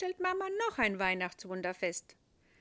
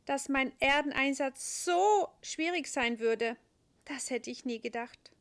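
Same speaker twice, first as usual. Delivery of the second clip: angel voice; 5 semitones higher, 6 dB louder than usual. A narrator's reading.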